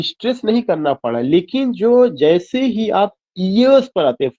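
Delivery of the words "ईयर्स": "ईयर्स" pushed higher and drawn out; stress on YEARS. Shown as a demonstration. Teacher showing students where stress falls, "ईयर्स" stressed. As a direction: lean hard on "ईयर्स". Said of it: "ये जो जोर देना पड़ रहा".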